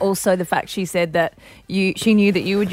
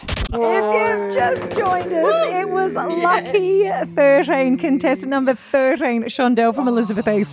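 radio announcer; 5 semitones higher than usual. Acted. Radio host announcing the win.

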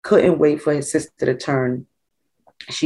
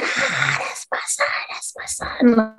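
breathy hushed cheer from the sideline, pitch climbing; proud mom cheering; 8 semitones higher, 4 dB quieter, slower than usual. Retold or imitated. Team mom at the field cheering her son's touchdown, hushed proud cheer. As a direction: cheer on your son from the end of the field.

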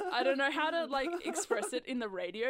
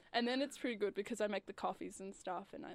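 usual speaking voice

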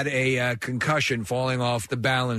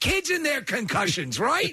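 high-pitched retold quip to dad; nervous joking kid; 9 semitones higher than usual. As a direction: pitch it up, fishing for agreement. Retold child lightening dad's bad news.

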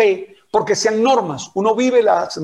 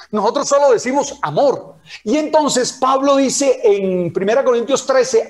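ordinary talk

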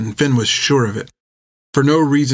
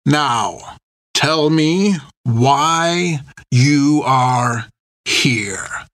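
sharp, slow, cutting demand; sharper; 3 semitones higher, much slower than usual. A narrator's reading. The captain presses Finn, sharper and colder. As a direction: bite off the demand with menace. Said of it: "his voice sharper than before".

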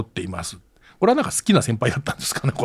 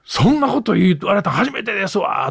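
retold angry retort; 8 semitones higher than usual. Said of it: "めちゃめちゃ怒られて".

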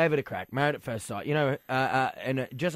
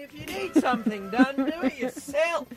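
very high, squeaky, slow helium voice; 12 semitones higher than usual. Real, not acted. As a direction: talk high and thin on helium. After inhaling helium.

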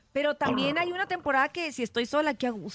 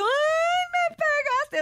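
shrill high mock yelp of pain, much louder; retold startled cry; 4 dB louder, much slower than usual. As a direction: cry out as if struck awake in the night. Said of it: "y yo".

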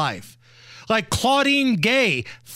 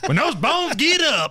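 a hint of a put-on Southern preacher, a bit higher; host mimics Hillary's Southern church voice; mock Southern accent; 6 semitones higher than usual. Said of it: "that accent come out".

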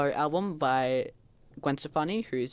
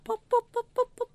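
short, high popping noises; imitated bubble pops. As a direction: pop like video-game bubbles. Imitates bubble synth sounds.

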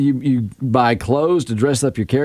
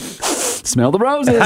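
mock romantic offer; 6 semitones higher, much slower than usual. Hamming up a Valentine's gift.

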